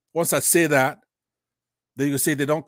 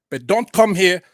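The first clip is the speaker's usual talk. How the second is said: loud, raised-pitch rebuke; angry warning; 5 semitones higher, 8 dB louder than usual. Real, not acted. Speaker defending Alex against his critics, angry warning. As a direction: warn them off forcefully.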